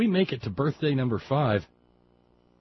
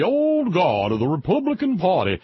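put-on old-guard Southern accent; 4 semitones higher, 4 dB louder than usual. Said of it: "I'm saying that with that accent for a reason".

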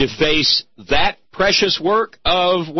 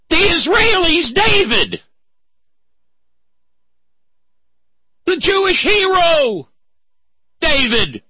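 rising indignation; 12 semitones higher than usual. Real, not acted. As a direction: drive each phrase up with outrage. Building indignant emphasis.

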